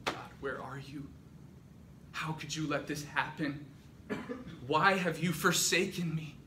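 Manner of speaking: restrained plea, building in force; anguished questioning. Acted. Captured believers thinking toward God before martyrdom, anguish building. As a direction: question God, starting subdued and rising.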